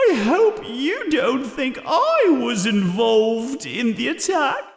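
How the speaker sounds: high, wailing ghost voice; mock-tearful, hurt protest; crying out. A narrator's reading. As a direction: cry it out in a high, plaintive pitch. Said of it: "she cries very loudly".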